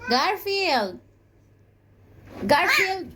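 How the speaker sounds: high call across the room. Real, not acted. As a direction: call the cat from afar. Calling out to the cat.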